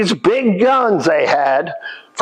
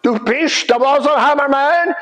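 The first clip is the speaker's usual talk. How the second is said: a higher, questioning Pennsylvania Dutch voice; Pennsylvania Dutch accent; 7 semitones higher than usual. Retold or imitated. Guide acting out the Pennsylvania Dutch forge men, in their accent. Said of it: "they called this man the Wasserhammer man".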